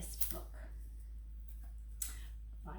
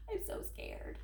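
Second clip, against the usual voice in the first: fearful whisper; 11 dB quieter than usual. Acted. Grover whispers to the kids that he is scared. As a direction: whisper your fright. Fear repeated hushed.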